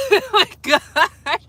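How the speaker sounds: high and slow, laughing through it. Real, not acted. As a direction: giggle helplessly at yourself. Laughing at her own coughing fit.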